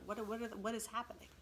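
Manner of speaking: usual speaking voice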